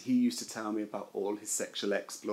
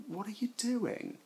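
high, softly incredulous retold question; mock incredulous; 10 semitones higher, 4 dB quieter than usual. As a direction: question him in lifted-pitch disbelief. Retells questioning unwell friend.

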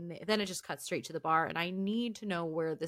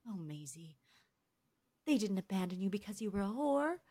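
a faintly sweet, faster put-down; Bree's put-down; faster than usual. Retold or imitated. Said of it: "And Bree goes".

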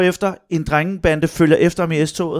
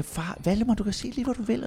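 higher, hushed encouragement; lightly voiced parents; 7 semitones higher, 10 dB quieter than usual. Parents to son in therapy, softly encouraging. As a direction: softly imitate parents coaxing a child. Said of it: "Og, og vi siger".

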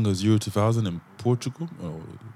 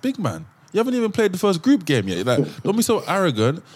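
higher-pitched mock scolding; incredulous, higher-pitched rebuke; exasperated telling-off; 8 semitones higher than usual. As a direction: take them down a peg, pitched up. Mock telling-off of boastful media.

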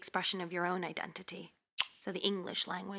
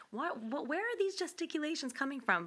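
higher-pitched imitation of mom; puzzled mom; 7 semitones higher than usual. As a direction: lightly mimic a mother questioning her child. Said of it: "my mom's forever telling me".

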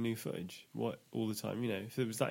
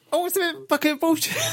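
squeaky high squirrel voice, louder; 8 dB louder than usual. Pretend. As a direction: pitch way up like a tiny angry squirrel. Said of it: "a squirrel voice".

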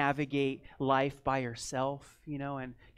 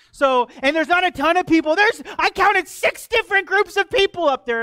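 loud, higher arguing back; heated retort; 9 semitones higher, 14 dB louder than usual. Retold or imitated. Pastor retelling how he snapped back at the neighbor, loud. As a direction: push back hard in a raised voice. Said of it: "I'm like".

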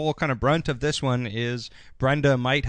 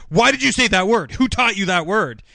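louder, higher mock outrage; mock outburst; 7 semitones higher, 6 dB louder than usual. Old lady Andrea flipping out on Brandon, mock outraged yell. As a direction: snap at him, higher and louder. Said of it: "She's like".

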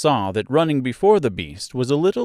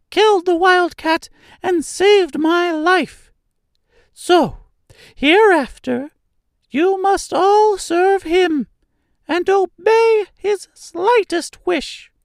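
very high, louder royal squeak; squeaky mouse queen; 5 dB louder, slower than usual. A narrator's reading. Mouse queen orders the mice to serve her rescuer, squeaky decree. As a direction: decree it as a tiny mouse monarch. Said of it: "in a squeaky little voice".

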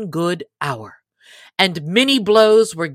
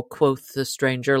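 usual speaking voice

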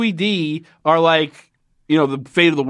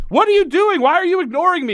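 mock yelling; 13 semitones higher than usual. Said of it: "yelling at him".

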